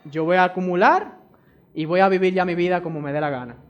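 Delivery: ordinary talk, nothing marked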